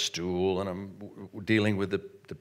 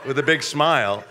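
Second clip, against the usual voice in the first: ordinary delivery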